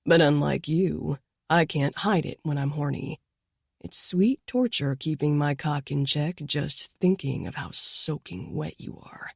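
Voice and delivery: a hint of a husky lover; low intimate male voice. A narrator's reading. Danilo speaks low and intimately.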